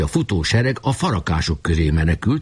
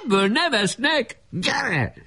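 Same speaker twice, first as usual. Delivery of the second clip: put-on high-pitched, urgent boy's call; rally the troops, voice pitched way up; excited high shouting voice; excited battle cry; 12 semitones higher than usual. Character shouts orders in battle.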